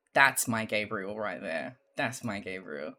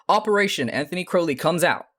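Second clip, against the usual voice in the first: loud commanding American boss; Gabriel voice; 6 semitones higher, 8 dB louder than usual. Acted. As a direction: bark it like a drill leader. Gabriel announcing at whiteboard.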